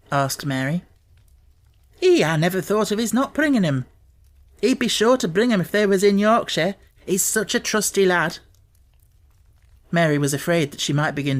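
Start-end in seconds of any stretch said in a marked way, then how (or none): 2.0-8.4 Martha's Yorkshire voice. A narrator's reading. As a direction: voice the country maid gently.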